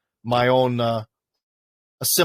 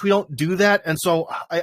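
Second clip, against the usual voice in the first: ordinary talk, nothing marked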